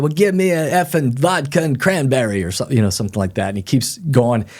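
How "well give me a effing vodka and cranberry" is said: put-on higher, brash Northeastern US accent; mock Northeastern bar regular, pitched up. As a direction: imitate a pushy Northeastern guy at the bar. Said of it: "He's got an accent and everything".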